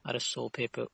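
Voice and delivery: ordinary talk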